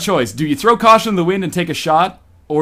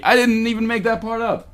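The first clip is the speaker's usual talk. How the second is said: normal talking voice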